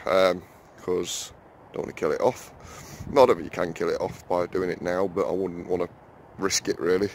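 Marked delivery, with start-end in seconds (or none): none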